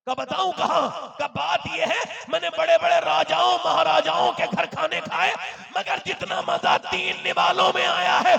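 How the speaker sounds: quoted exchange climbing from loud to a high shout; thundering sermon climax, pitched high; full-force preaching. Acted. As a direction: build to a shouted, ringing revelation.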